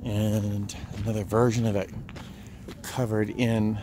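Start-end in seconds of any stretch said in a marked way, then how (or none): none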